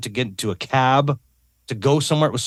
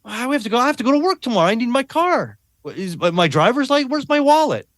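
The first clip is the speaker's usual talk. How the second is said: high and frantic, firing questions; confused father hunting for his wallet and licence, frantic; spiraling panic; 9 semitones higher than usual. Retold or imitated. Panicked, high, breathless questions. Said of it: "he spiraled for, like, an hour".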